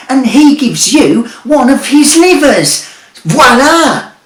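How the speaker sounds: high, loud brash wizard full of bluster; a cocky market trader of a wizard selling junk. A narrator's reading. Wizard mocking Jeremy and selling him a sat-nav, brash and loud.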